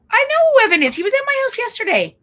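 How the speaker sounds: high, loud, delighted parent praise; gushing praise. Retold or imitated. Oh, I would say.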